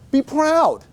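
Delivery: "proud" flung out high and held; rousing call. Acted. Manager rallying his sales team, pitch jumping high on "proud". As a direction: lift the whole room on "proud".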